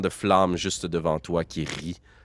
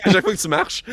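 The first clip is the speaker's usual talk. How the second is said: teasing singsong; 11 semitones higher, 5 dB louder than usual. Pretend. GM teasing about the bell.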